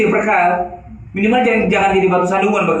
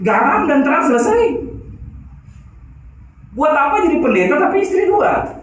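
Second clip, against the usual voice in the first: raised, higher preaching voice; fiery preaching; 5 semitones higher than usual. Acted. Pastor exhorts congregation to change, fiery preaching. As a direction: preach it hard to the room.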